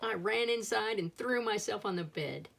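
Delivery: ordinary delivery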